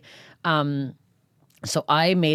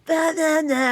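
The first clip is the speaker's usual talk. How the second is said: put-on high, loud drunken nah; drunken dismissive yell; 14 semitones higher, 3 dB louder, much slower than usual. Blackout drunk comic backstage, loud dismissive drunk nahs. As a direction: wave everyone off, drunk and ranting. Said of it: "fucking going off".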